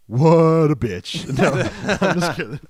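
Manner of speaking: normal talking voice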